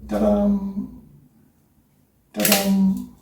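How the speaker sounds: high-pitched da-dum of a heart; imitated heartbeat. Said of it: "and his heart goes".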